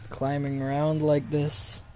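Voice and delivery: ordinary delivery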